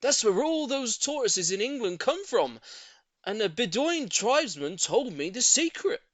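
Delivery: high, loud knowing tone; storytelling neighbour. A narrator's reading. Neighbour inventing a secret for the lady, loud knowing. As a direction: spin the tale with confidence. Mr Hoppy voiced.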